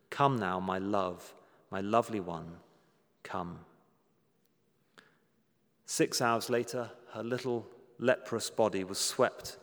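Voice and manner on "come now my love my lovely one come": lower, gentle beckoning that sinks to a hush; tender call. Retold or imitated. Closing refrain of loving call.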